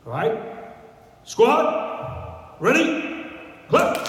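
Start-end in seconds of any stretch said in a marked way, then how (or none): 1.2-4.1 loud, high barked drill call; drill command. Shown as a demonstration. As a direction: call the squad to attention. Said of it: "So now it's your turn".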